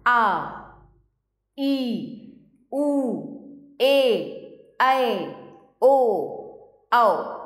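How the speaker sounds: each vowel आ ई उ ए ऐ ओ औ held long and ringing out; sustained long Hindi vowels, called out clearly. Shown as a demonstration. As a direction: draw every vowel out for its full length, one by one. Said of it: "सुनिएगा ध्यान से".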